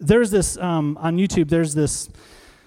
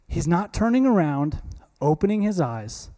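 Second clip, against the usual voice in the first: ordinary talk, nothing marked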